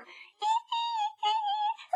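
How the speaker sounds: very high, soft repeated squeals; tiny high eee-eee-eee; mouse squeaking. A narrator's reading. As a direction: squeak like a frightened little mouse. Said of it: "запищал мышонок".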